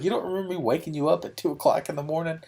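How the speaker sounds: high, fast disbelieving question; can't believe he forgot; incredulous question. Retold or imitated. Asking friend Steve how he forgot, high and incredulous.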